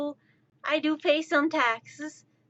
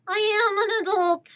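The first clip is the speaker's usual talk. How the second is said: higher, louder mock indignation; insist, stung and emphatic; indignant protest; 7 semitones higher, 5 dB louder, faster than usual. Protesting that they are an adult.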